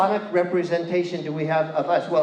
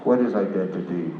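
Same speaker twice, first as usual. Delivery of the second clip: much lower, mock-mysterious question; deep voice; 10 semitones lower than usual. Performer playing with slippery identity, deep voice. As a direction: drop the pitch far down.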